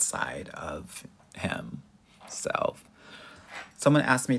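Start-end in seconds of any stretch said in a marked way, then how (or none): 0.0-2.8 whispering. Real, not acted. Drops to a slow hush.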